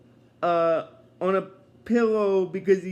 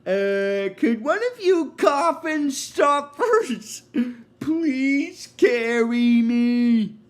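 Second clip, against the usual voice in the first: cartoon voice; 5 semitones higher, 4 dB louder, much slower than usual. Crashed character begging for help.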